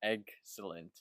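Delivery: "Excellent" split into "egg ... sellent", softly; slowed, sounded out. Shown as a demonstration. Friend repeats after co-host, sounding out "Excellent" slowly. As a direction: sound out "Excellent" slowly in two careful parts. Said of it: "slow down and sound it out".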